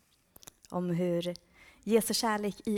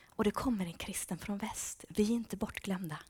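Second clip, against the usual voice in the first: hushed, awed whisper; whispered "we are not forgotten"; 6 dB quieter than usual. Retold or imitated. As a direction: whisper it like hidden believers. Voicing secret Christians' joy.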